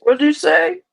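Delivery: laughing echo. Pretend. Echoing the mock outrage.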